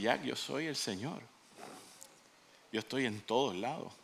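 quiet, low, composed reply; calm divine voice. Acted. God revealing himself to frantic Jack, quietly calm. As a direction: play God with understated calm.

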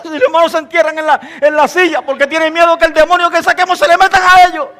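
ordinary talk

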